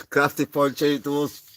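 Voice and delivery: drawn-out, loud announcement; loud announcing voice. Acted. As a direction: announce the name slowly and loudly. Reading a viewer's name.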